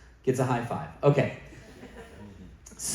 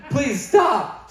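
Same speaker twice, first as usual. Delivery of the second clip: pleading; 9 semitones higher, 5 dB louder than usual. Retold or imitated. Texter begging after emoji flood.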